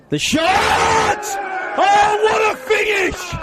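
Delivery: soaring, shouted goal call; long goal yell. Real, not acted. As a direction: erupt as the ball hits the net. Goal scored, commentator erupts.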